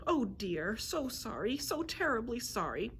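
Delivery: slightly high, fretful creature voice; apologetic griffin. A narrator's reading. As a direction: fuss and apologise as a clumsy griffin. Clumsy griffin apologises after crashing.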